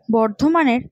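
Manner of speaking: ordinary talk, nothing marked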